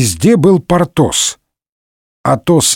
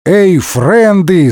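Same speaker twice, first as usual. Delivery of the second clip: calling out; 5 semitones higher, much slower than usual. A narrator's reading. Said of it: "закричал Атос".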